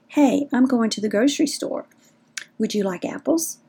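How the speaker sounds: unhurried question with a clear pause; calm, paused. Shown as a demonstration. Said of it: "in a calmness, wait for their answer".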